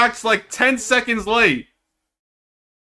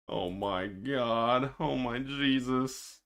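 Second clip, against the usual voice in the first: mock despair; 8 semitones lower, 13 dB quieter, slower than usual. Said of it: "I get emotional when I see".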